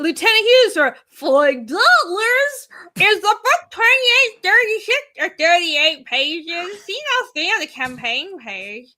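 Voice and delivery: high loud mock voice, slowed at first; imitating Lieutenant Hughes. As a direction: ham up a viewer's silly voice. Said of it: "you gotta do the voice".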